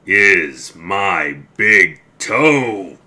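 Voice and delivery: slow, loud, looming moan; groaning ghost. Acted. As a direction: moan it right over the bed. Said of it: "the voice groaned".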